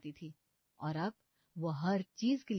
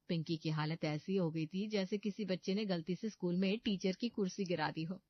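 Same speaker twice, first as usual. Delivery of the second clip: ordinary delivery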